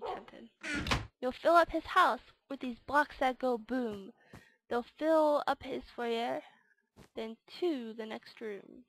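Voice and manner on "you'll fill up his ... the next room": louder, rhythmic storybook narration; game verse read-aloud. Acted. Gamer reading out the Grinch quest, rhythmic storyteller voice. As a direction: declaim the rhyme like a mischievous storyteller.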